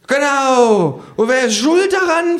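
sehr hohe, laute Anklage; laut und hoch losschimpfen; fluchendes Känguru. Acted. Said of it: "flucht das Känguru". (hohes Känguru-Fluchen)

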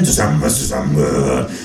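bigot cheering. Acted. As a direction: bellow it like a beer-tent patriot. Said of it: "ruft Freundlich".

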